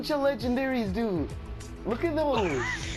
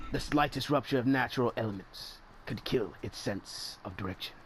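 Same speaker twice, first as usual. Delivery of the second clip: documentary narrator; 9 semitones lower, 6 dB quieter than usual. Acted.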